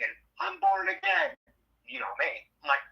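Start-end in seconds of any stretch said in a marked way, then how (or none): 0.4-1.4 high wailing mock sob, much higher; mock crying. Caller on the phone to the DJ, mock tearful wail. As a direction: whine it like a sobbing caller. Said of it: "And she's crying".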